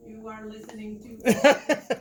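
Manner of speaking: slow, loud announcer robot; like a pre-recorded station voice; automated voice. Shown as a demonstration. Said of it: "let's hear your automated voice".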